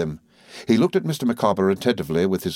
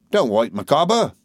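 higher-pitched order to a clerk; curt employer; 6 semitones higher, 3 dB louder than usual. A narrator's reading. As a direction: dismiss an underling in character. Uriah orders his clerk out.

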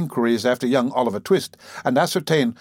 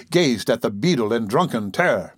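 ordinary delivery, nothing marked

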